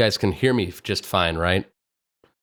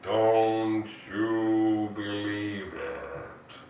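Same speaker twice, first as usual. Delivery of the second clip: very slow, drawn-out cartoon line; Tom and Jerry line; 3 semitones lower, 6 dB quieter, much slower than usual. Shown as a demonstration. Streamer trying the Tom and Jerry line for chat. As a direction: stretch it out like the cartoon. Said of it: "I'll try that".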